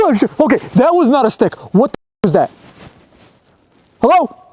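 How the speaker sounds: high, frightened outburst; jolted by a noise in the dark; scared call into darkness; startled fear. Real, not acted.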